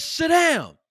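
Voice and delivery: mock manager barking sit down; barked order. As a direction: bark it like an angry boss. Teller repeats manager's order.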